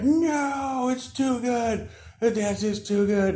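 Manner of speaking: very high, wailing mock agony; overwhelmed Frog King. Frog King defeated by the dance, hammed-up wail. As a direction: wail as if undone by brilliance.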